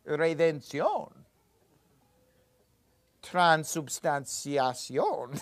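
propiciación and tribulación slow, in a Spanish accent. Shown as a demonstration. Teacher shows class how to fake Spanish words, Spanish accent. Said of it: "make it sound Spanish".

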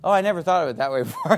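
quick, laughing fake amazement; mock surprised reply. Preacher imitates a stumped visitor, quick laughing mock. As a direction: play it as sheepish realization. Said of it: "that's what they always say".

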